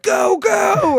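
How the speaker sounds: high mock cheer. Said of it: "I'm like".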